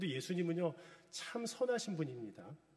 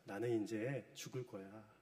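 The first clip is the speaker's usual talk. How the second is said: softer, slow, solemn quiet voice; Jesus foretelling death; 6 semitones lower, 7 dB quieter, slower than usual. Retold or imitated. Jesus telling his disciples he will die, soft and slow. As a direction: speak it gently, slowly, resigned. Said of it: "계속 말씀을 하셨어요".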